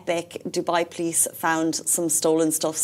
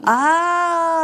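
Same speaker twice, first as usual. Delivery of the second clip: ordinary talk, nothing marked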